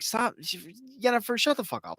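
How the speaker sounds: high-pitched, exasperated scolding; exasperated rant. Retold or imitated. Fed-up viewer scolding Yennefer on screen, high and exasperated. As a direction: tell her off like a fed-up friend.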